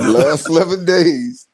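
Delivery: LAST ELEVEN DAYS drawn out high; emphatic repeat. Real, not acted. Drives home his repeated point.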